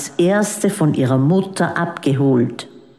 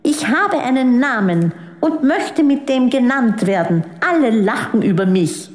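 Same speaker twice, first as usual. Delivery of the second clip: lightly voiced child venting; mild stamping fury in a child's voice; raging child; 7 semitones higher than usual. A narrator's reading. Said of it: "tobte und stampfte mit den Füßen".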